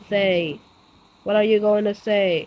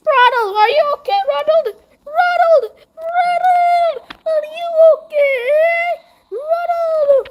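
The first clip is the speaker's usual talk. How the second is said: high, loud panicked calls for a friend; frantic cries to a fallen friend; panicked shouting; 3 dB louder than usual. Acted. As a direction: yell his name in alarm, pitched up high. Friend collapses, calling out panicked.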